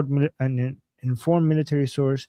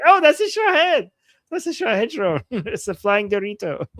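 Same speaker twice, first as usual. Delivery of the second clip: high-pitched cry of recognition, breaking into laughter; laughing mock exclamation; 8 semitones higher, 3 dB louder than usual. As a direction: blurt it out high through laughter, imitating everyone. Acts out everyone recognizing drone, laughing.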